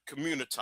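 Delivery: "community" pointedly ending in "tah"; stressed altered pronunciation. Shown as a demonstration. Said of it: "let me stress that word".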